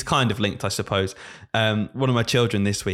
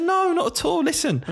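high reassuring. Retold or imitated. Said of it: "I said".

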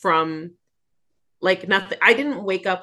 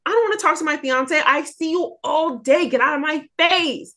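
higher, fast, exasperated mock complaint; fed-up rant; 8 semitones higher than usual. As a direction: blurt it out like you're sick of him. Annoyed at fiance during covid.

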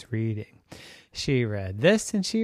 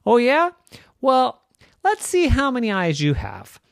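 high, louder sassy challenge; mildly annoyed; 10 semitones higher, 4 dB louder than usual. Acted. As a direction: turn the tables on her brother. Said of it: "get a little bit annoyed".

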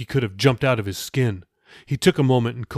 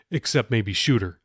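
ordinary delivery, nothing marked

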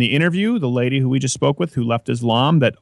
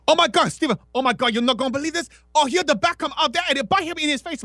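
high, far-off put-on voice of an over-eager friend; mock excited friend; 13 semitones higher, faster than usual. Imitating Kevin gushing to his friend during a movie, high and breathless. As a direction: squeal it like a friend who can't keep a secret.

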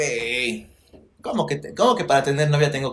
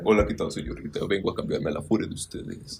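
much lower, softer suave greeting; lower, softer mock seductive hello; deep seductive voice; 7 semitones lower, 3 dB quieter than usual. Shown as a demonstration. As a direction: put on a low smooth flirting voice. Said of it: "Practica tu voz".

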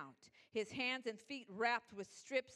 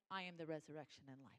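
softly, almost under the breath, quick; hushed murmur; 7 semitones lower, 11 dB quieter, much faster than usual. Acted. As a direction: murmur it like a private promise. God's words, quietly.

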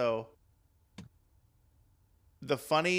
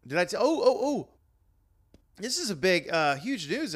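high, loud sudden realization; excited; 6 semitones higher, 5 dB louder than usual. Real, not acted. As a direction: burst out with excitement. Sudden big news remembered.

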